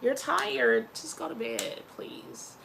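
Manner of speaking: ordinary talk, nothing marked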